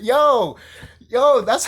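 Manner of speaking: bursting with fan excitement; overexcited. Real, not acted. Overexcited about the show.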